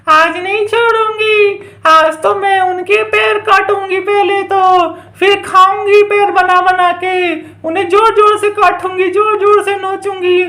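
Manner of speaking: slow, drawn-out witch threats; menacing witch. Pretend. Witch threatens to eat the mother's children, drawn-out menace. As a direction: stretch the last words with gleeful menace.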